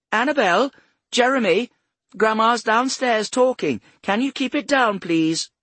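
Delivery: grandma calling upstairs, high and loud. A narrator's reading. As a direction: shout up to noisy grandchildren. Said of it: "She raised her voice".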